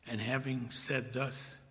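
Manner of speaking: solemn hushed telling. Acted. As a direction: let it fall away quietly. Death of Jesus, read hushed.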